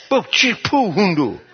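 high imitated driving sounds, dropping at the end; little nonsense noises of zipping along; playful zooming noises. Preacher retelling his speeding drive, playful sound effects.

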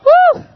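high, loud whoop of delight; loud woo. Acted. TV cook whooping at her own recipe, loud cheer. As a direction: let out a big cheering whoop. Host cheers after adding spice.

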